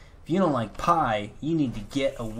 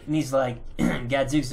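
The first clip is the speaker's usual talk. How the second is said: ordinary talk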